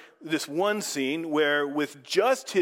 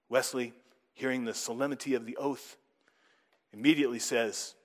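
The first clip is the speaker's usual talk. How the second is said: usual speaking voice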